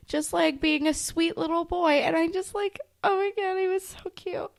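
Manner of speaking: high, excited gushing over a tiny kitten. Real, not acted. Friend recalls a kitten in class, high excited gushing.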